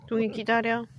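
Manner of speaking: loud, firm commands to a dog. Real, not acted. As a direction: tell the dog to wait and sit.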